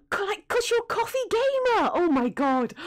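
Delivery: high, excited realization. Real, not acted. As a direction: burst out with delight.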